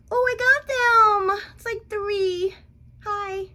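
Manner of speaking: high, slow, squeaky delight; pleased surprise. Real, not acted. Vlogger checking wax strip, high surprised delight. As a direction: react to the strip coming off clean. Waxing pull reveals result.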